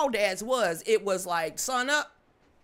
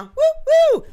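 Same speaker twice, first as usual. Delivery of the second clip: cheering whoop; 14 dB louder than usual. Real, not acted.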